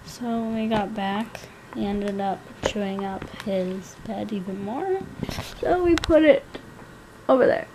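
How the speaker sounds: quiet. Real, not acted. Quiet later narration.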